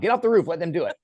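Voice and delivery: high, loud self-scolding shout; mock yell. Telling his past self to obey firefighters, mock yell.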